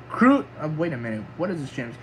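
ordinary talk

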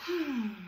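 slow, soft demonstration sigh; deep breath out. Shown as a demonstration. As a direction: breathe out to show the children. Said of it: "take a deep breath".

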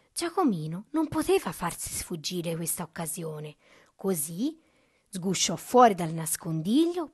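plain reading voice

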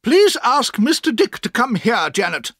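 high, crisp orders to the servant; a commanding old lady's voice; brisk aunt. A narrator's reading. Aunt orders servant Janet to fetch Mister Dick, briskly.

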